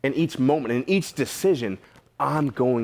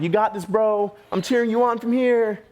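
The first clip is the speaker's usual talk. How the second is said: cheering bro; 10 semitones higher than usual. Acted. Disciples in life jackets cheering Peter on, hammed-up high cheer. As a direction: play the lazy buddy cheering from the boat.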